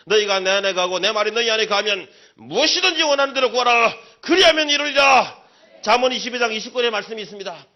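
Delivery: loud, high preacher's exhortation; fiery preaching. Acted. Pastor exhorting the congregation, loud fiery preaching. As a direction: drive it home to the congregation with full force. Preaching scripture at full force.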